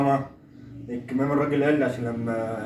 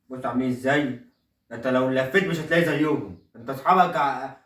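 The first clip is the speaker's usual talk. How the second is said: ordinary delivery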